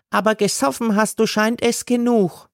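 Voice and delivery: high, quick mocking woman voice; mocking seller. A narrator's reading. Gailana mocks God for drinking, high and quick. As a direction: jab at the drunk.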